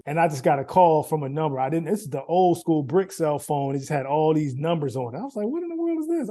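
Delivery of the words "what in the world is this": high, baffled question; puzzled surprise. Retold or imitated. Retelling a mystery call, high and baffled. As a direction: react to a strange number on the phone. Surprise at unknown phone number.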